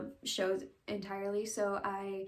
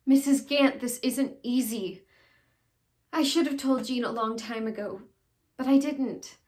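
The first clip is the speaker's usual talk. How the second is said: louder, emphatic stage confession; dramatic monologue; 3 semitones higher, 9 dB louder than usual. Acted. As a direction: confess it forcefully to an older woman. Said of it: "I'll be doing a monologue".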